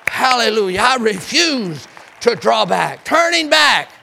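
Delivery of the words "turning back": preached call. Shown as a demonstration. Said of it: "Let's say it again".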